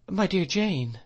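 high-pitched gentle protest; remonstrating. A narrator's reading. Mother objects to being called a fool, remonstrating. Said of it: "remonstrated my mother".

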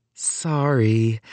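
small sheepish apology; a touch of embarrassment. A narrator's reading. Sophia owning up to her rumbling stomach, sheepish. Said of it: "said Sophia sheepishly".

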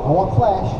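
ordinary delivery

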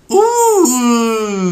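sudden high squeaky ooh; the voice jumps up and breaks; real accidental voice crack. Real, not acted. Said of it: "I don't know why my voice just did that".

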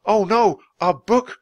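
high, loud dismayed outcry; cry of dismay. A narrator's reading. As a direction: cry out over a dropped treasure. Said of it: "cried Jack".

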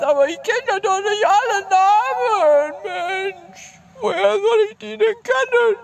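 high whiny squeak; whiny falsetto. Pretend. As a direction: whine it up high. Mock complaint about unknown names.

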